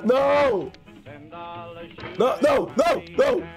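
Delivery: high desperate shouts; despairing cry. Real, not acted. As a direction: wail it out. Falling again.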